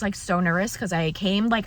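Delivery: ordinary talk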